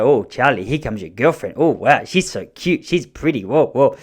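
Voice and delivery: sing-song swooping teasing voice; playful teasing. Retold or imitated. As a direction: tease a little boy about a girl. Dad's joke teasing his son.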